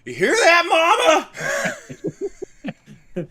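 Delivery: high, slow, southern belle drawl. Pretend. Mock southern drawl after clout brag.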